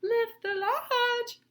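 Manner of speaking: high, very slow wolf coaxing. Acted. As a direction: draw it out sweetly as the wolf. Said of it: "in a softer voice".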